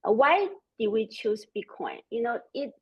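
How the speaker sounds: ordinary delivery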